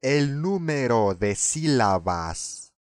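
"sílabas" said slowly and clearly, each syllable separate; slow correction. Shown as a demonstration. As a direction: spell out "El número de sílabas" syllable by syllable. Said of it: "teacher corrects sílabo".

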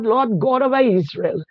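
ordinary delivery

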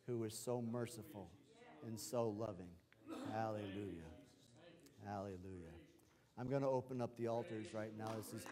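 ordinary talk

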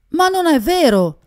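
high, loud outraged cry; shouting. A narrator's reading. Young man shouting down the waiter's lie, outraged yell. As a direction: shout the denial, pitch leaping up. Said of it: "urlò letteralmente Jean Chabot".

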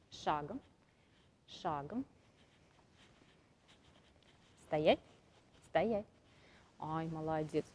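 soft, low commands to a horse; quiet commands. Real, not acted. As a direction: murmur the commands.

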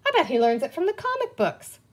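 high-pitched, delighted guess; delighted exclamation. A narrator's reading. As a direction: share an exciting discovery, pitch up. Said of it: "Penny exclaimed in delight".

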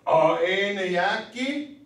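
drawn-out teaching question; slow demo question. Shown as a demonstration. Teacher modelling a question for students, slow and drawn out. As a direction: stretch "niyaki" slowly as a model question. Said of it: "Are you reading".

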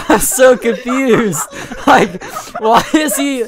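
real laughter breaking up the sentence; laughing through words. Real, not acted. Laughing hard while retelling confusion.

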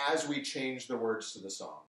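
normal talking voice

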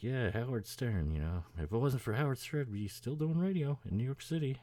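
lightly voiced grumble about Howard; Kenny impression. Kenny blaming Howard Stern, high mock grumble. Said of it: "But he's like".

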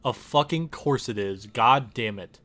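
ordinary delivery

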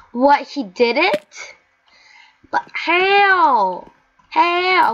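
high, loud disbelieving cry; stunned by the character; astonished. Real, not acted. Gamer amazed at success, high surprised cry.

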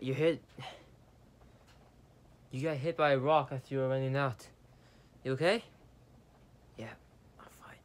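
usual speaking voice

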